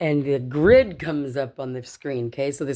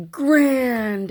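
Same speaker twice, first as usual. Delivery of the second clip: high, drawn-out announcement of GRAND; GRAND sung out high and long; big reveal; 9 semitones higher, 4 dB louder than usual. Retold or imitated. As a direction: announce the top prize like huge letters on screen. Voicing huge letters on screen.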